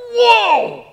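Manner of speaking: high, loud amazed whoa. Real, not acted. Preacher reacting to Jesus' harsh words, loud and high. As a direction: react as if blown back.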